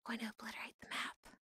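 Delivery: fast conspiratorial whisper; playful plotting whisper; mock whisper. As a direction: hiss the plan to chat like a secret. Scheming to chat about chocolate.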